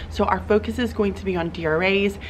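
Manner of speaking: ordinary delivery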